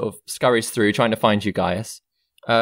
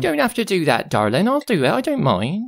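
high, lilting obliging servant woman; Jazana, obliging; 9 semitones higher than usual. Acted. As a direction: brush off the offer in a high lilt. Voices Jazana.

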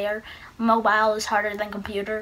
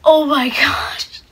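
louder burst of disbelief; excited; 4 semitones higher, 5 dB louder than usual. Real, not acted. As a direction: blurt it out, thrilled. Young self stunned after the win.

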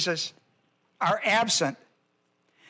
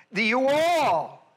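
"the you all" drawn out slowly, higher pitched; emphatic stress; 4 semitones higher, slower than usual. Real, not acted. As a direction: stretch ALL in "the you all" with a swooping rise and fall. Stresses whom the verse addresses.

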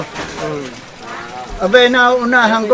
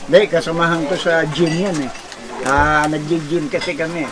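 lightly acted, lower-pitched explaining husband; excusing husband; 5 semitones lower, 5 dB quieter than usual. Husband explains gym acquaintance to wife, lightly excusing. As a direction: play the husband making a low-key excuse.

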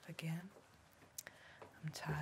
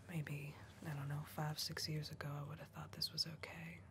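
whisper. Acted. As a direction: keep it all breath, no voice. Whispered roleplay throughout.

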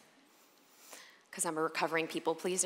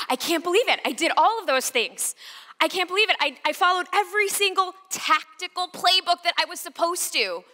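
much higher, loud furious rant; livid rant; 10 semitones higher, 6 dB louder than usual. Retold or imitated. Pacing the room ranting, high and livid. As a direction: rant while pacing back and forth. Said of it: "I was angry, and I was livid".

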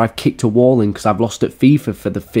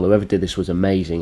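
usual speaking voice

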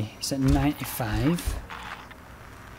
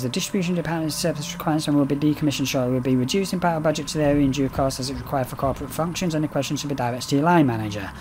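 fast reading; much faster than usual. Real, not acted. Reading in-game notice fast.

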